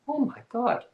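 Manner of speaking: high, breathy oh my God; mock-horrified mom gasping; shocked gasp. Mom's shocked reaction retold.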